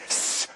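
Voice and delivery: a soft drawn-out snake hiss. Acted. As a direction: hiss away into smoke. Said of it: "and a hiss".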